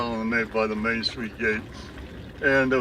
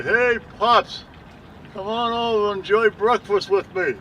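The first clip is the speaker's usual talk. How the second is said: called out much higher and louder, a stranger's invitation; deep voice calling; 8 semitones higher, 5 dB louder than usual. Retold or imitated. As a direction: hail him across the graves like a cheerful stranger. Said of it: "I heard a deep voice say".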